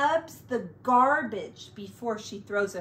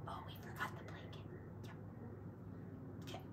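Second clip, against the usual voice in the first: fast hushed whisper; 18 dB quieter, much faster than usual. Real, not acted. Whispering at bedtime.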